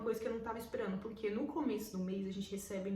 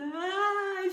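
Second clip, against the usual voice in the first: desperate wail; 10 semitones higher, 8 dB louder than usual. Retold or imitated. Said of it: "eu comecei a chorar desesperada".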